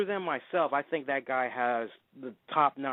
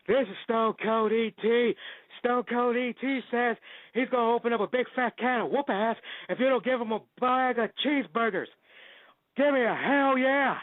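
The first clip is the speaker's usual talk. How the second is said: Stone Cold impression; 10 semitones higher than usual. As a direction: ham up a tough wrestler ordering at a drive-thru. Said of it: "doing one phenomenal Stone Cold Steve Austin impression".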